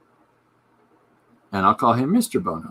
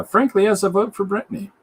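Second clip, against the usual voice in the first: mock high voice; 10 semitones higher, 4 dB louder than usual. Mimicking a voter's pick.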